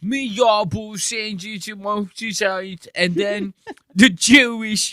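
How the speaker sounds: high, hammed-up British bloke voice; mock British accent. Streamer mocking a British guest, in a hammed-up British accent. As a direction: mimic a cheeky British lad, over the top.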